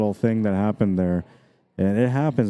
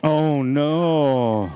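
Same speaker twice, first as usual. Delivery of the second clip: dismayed oh no; 6 semitones higher than usual. Real, not acted. Commentator sees the scratch, dismayed cry. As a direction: react to disaster at the table.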